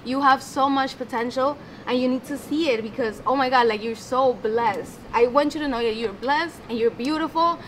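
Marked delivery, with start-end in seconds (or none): none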